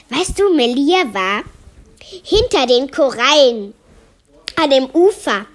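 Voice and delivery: usual speaking voice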